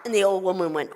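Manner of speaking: plain reading voice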